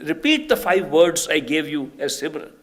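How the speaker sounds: loud, emphatic instruction; commanding. Retold or imitated. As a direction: order it as if reaching someone far away. Master commands her.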